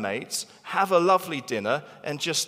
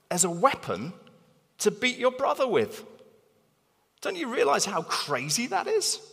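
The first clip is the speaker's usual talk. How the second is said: exasperated Paul; 7 semitones higher than usual. Retold or imitated. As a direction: voice Paul scolding, pitch climbing high. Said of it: "he's like".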